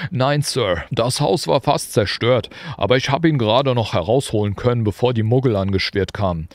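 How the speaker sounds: a proud, simple giant reporting; Hagrid. A narrator's reading. Hagrid reporting to Dumbledore, hearty and proud.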